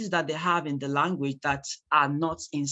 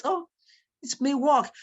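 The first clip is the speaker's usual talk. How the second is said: mock doubt; 9 semitones higher than usual. Mocking doubt.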